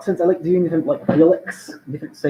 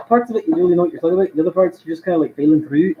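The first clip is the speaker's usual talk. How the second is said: ordinary talk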